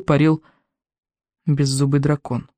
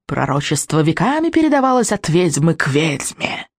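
purring witch; 4 semitones higher than usual. A narrator's reading. Witch telling Vikings of the prophecy, purring. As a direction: purr it theatrically, stretching key words. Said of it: "промурлыкала ведьма".

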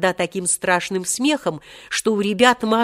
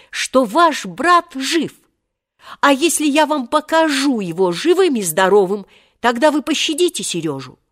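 put-on higher, insistent boy's voice; urgent bargaining; 6 semitones higher, 3 dB louder than usual. A narrator's reading. Volka bargaining to save Seryozha, urgent and higher. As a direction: press the offer eagerly.

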